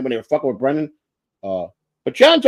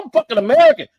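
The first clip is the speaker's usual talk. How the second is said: indignant protest; 14 semitones higher, 4 dB louder, faster than usual. Retold or imitated. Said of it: "I go".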